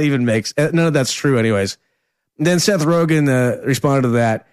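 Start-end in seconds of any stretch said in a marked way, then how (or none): none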